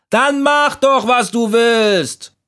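exasperated, very high call. A narrator's reading. Leaves giving up on the stubborn leaf, exasperated high call. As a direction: fling it out, high and drawn out. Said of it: "riefen die Blätter".

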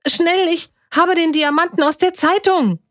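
high, breaking-news urgency; urgent calling. A narrator's reading. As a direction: burst in calling for your parents. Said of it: "riss die Tür auf und rief".

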